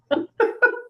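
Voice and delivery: a short high burst of nervous laughter. Real, not acted. Friend laughs to hold back tears, a high nervous laugh. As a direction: laugh it off before getting emotional.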